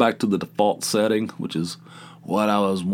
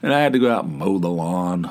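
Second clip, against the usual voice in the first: ordinary delivery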